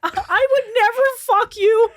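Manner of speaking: high, loud, slow indignant whine; mock pundit voice. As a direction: squeal it slowly and offended.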